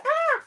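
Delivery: short, high startled scream; small high-pitched yelp of alarm. Real, not acted. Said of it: "I can still scream like a girl".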